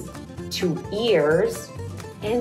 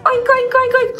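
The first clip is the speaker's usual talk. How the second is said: squeaky high oinking; high pig oinks; 13 semitones higher, 5 dB louder, faster than usual. Shown as a demonstration. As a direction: oink like a little piggy. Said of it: "act like a piggy with me".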